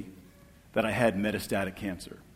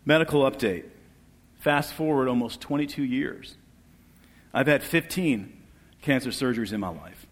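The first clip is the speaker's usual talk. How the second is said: ordinary talk, nothing marked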